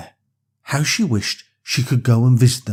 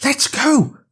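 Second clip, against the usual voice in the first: high, bright eager shout; eager cry; 13 semitones higher than usual. Acted. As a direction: call out ready for adventure. Lexi eager to leave.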